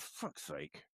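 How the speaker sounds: low, muttered under breath; muttered fuck's sake. Retold or imitated. Wife annoyed he woke up, muttering low. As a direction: sigh it out, annoyed. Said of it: "She's like".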